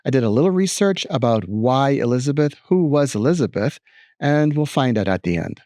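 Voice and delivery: normal talking voice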